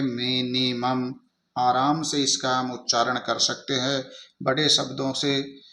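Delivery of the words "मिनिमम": slow, split into three syllables in "मिनिमम". Shown as a demonstration. Teacher showing how to read a big word in pieces, syllable by syllable. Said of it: "इस तरीके से हम इसको आसानी से पढ़ सकते हैं".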